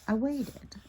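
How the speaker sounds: usual speaking voice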